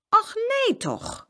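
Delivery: high, slow, drawn-out dismay; astonished exclamation. A narrator's reading. Farmer's wife hearing of two children, astonished cry. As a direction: exclaim it with long, stretched words. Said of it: "riep de boerin uit".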